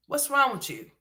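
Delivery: ordinary delivery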